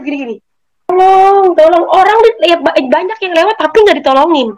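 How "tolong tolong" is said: high, drawn-out mock plea; acted cry for rescue, pitched up; retold call for help. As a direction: imitate someone calling out for help. Retold woman calling for help.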